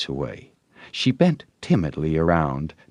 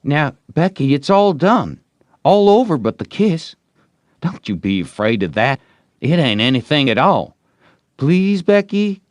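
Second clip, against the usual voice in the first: higher, louder eager pleading; boy pleading; 9 semitones higher, 6 dB louder than usual. Acted. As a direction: beg her sweetly to give in.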